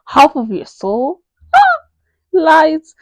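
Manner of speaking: loud, slow, incredulous; high, loud, thrilled protest; gushing disbelief. Real, not acted. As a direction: cry out in delight. Excited disbelief.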